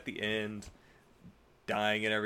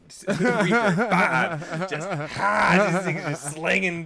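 laughing through the words; giggling out the names; 5 semitones higher, 7 dB louder, much slower than usual. Real, not acted. Laughing while reading donation shoutouts.

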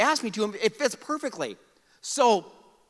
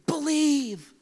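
whispered Believe, breathy and unvoiced; hushed Believe; 9 semitones higher than usual. Shown as a demonstration. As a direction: whisper Believe to your neighbor. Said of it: "Tell him".

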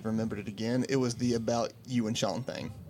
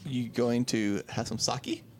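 usual speaking voice

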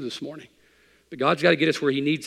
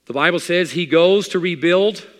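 loud, high, slow declaration; rising preacher; emphatic; 6 semitones higher, 9 dB louder, slower than usual. Acted. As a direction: preach it out slowly.

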